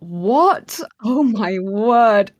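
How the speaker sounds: slow, stunned disbelief; a hushed, awed oh my word; stunned disbelief, drawn out slow; shocked gasp. Retold or imitated. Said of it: "So I was like".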